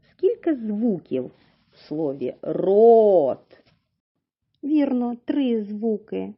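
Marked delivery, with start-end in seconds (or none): 2.4-3.6 рот with a long, loud О; slow рот, rolled Р and held О; drawn-out word. Shown as a demonstration. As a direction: stretch the vowel in рот right out. Stretches word for sound analysis.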